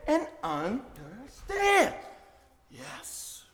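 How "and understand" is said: "and understand" drawn out slowly, falling; slow emphasis. Shown as a demonstration. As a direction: stretch "understand" out and let it drop. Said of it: "he didn't stop there".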